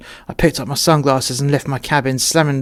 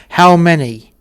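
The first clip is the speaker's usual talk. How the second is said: louder, higher, pressing repeat of the question; stern demand; 4 semitones higher, 7 dB louder, slower than usual. Retold or imitated. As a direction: bark it like an officer losing patience. Officer repeats question to crew.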